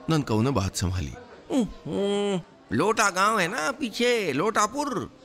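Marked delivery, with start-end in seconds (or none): 1.5-5.2 high, quick improvised excuse; covering up. Acted. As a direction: talk your way out, much higher. Compounder inventing cover story.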